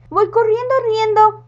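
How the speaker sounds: high, loud frantic witch voice; timid witch gives in, frightened. Acted. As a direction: squeal it in a panic. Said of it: "dijo la bruja, que era bastante miedosa".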